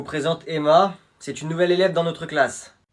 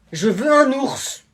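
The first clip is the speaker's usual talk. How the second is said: higher, slow insistent whine; whiny insistence; 8 semitones higher, 6 dB louder, much slower than usual. Acted. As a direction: drag it out like a spoiled brat. Son insists on the bear.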